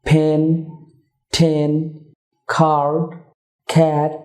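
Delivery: ফ্যান ঠেন খার্ড খ্যাট with puffed initial consonants; aspirated examples. Shown as a demonstration. Teacher listing puffed example words to viewers. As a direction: breathe out hard on each first consonant. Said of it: "যেমন".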